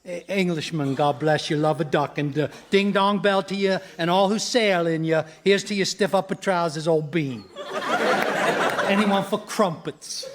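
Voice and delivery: hammed-up fake English accent; ridiculous English accent. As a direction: pose as an Englishman with an absurd accent. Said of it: "that ridiculous accent".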